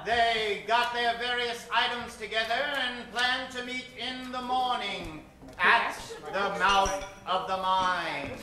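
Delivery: normal talking voice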